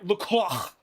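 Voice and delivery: imitated French disgust, throaty R; mock French radio disgust; disgusted French accent. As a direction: spit out the name like an appalled French driver. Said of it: "disgust in his French accent is outrageous".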